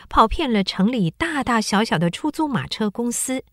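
ordinary talk

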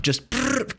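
high rolled "brrr" like a machine gun burst; spray the room with a rolled "brrr"; quick imitated automatic-weapon trill; imitated gunfire.

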